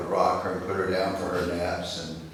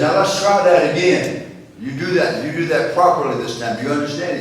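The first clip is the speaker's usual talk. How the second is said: higher, louder stern grandpa laying down the law; firm correction; 7 semitones higher, 9 dB louder than usual. Retold or imitated. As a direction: make the kid redo it, half-joking sternness.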